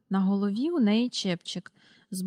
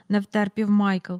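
plain reading voice

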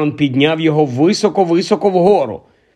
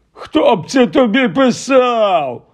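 high, slow mocking sneer; 8 semitones higher, slower than usual. Uncle sneering at Harry over his letter, slow mock. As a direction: sneer the question at the boy. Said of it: "вишкірився дядько".

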